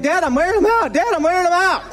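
lightly voiced eager kid, pleased with himself; son's proud claim. Son boasting to dad from the sideline, lightly voiced eager kid. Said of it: "He's like".

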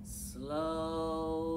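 drawn out. Shown as a demonstration. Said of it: "pound your fist slowly".

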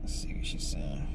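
hushed whisper, low. Real, not acted. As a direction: whisper so she can't hear. Secretly reading her texts.

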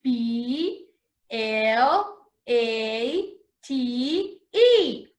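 letters spaced out slowly; slow, careful spelling. Shown as a demonstration. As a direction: spell each letter clearly for kids. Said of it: "let's spell plate".

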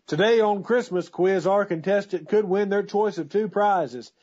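big game show prize read; booming prize announcer. Acted.

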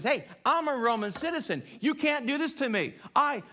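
acted-out loud, high protest; indignant protest. Preacher acts out beaten Apostle Paul, loud indignant protest. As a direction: cry out indignantly, high and forceful. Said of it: "he was beaten, and he says".

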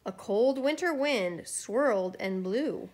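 plain reading voice

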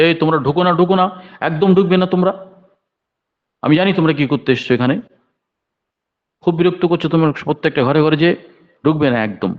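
high-pitched, mildly shouted mock scolding; retold angry shout. Old man stopping youngsters at the gate, retold shouting. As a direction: imitate an old man's cry to keep out. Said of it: "চিৎকার বলছে".

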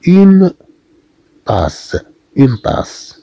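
une tasse with a clear s sound; French pronunciation demo. Shown as a demonstration. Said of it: "الحصول على الصوت اس". (نطق une tasse بصوت السين واضحًا)

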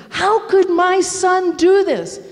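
high, slow, heartbroken cry; anguished plea. Retold or imitated. Mother begs God to explain her son, anguished. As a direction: wring it out like a desperate prayer. Distraught mother praying aloud.

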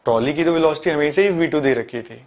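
ordinary talk

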